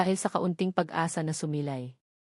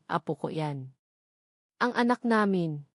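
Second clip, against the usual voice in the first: tender hush; 3 semitones lower than usual. Acted. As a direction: let it fall gently, like a private thought. Imagined parents' tender inner voice.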